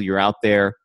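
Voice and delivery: ordinary delivery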